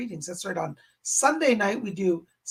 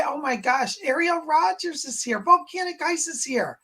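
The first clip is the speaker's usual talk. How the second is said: normal talking voice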